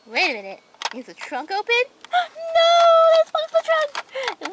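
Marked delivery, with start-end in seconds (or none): 2.1-4.5 high gasping cry, slowing on the second line; terrified cry. Acted. Driver realizing the bugs are inside, crying out high. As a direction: cry out in horror at what you find.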